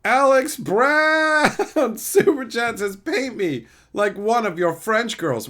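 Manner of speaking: high, loud Super Chat read-out; hyped announcement. Acted. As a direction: belt it out theatrically, then ease slightly.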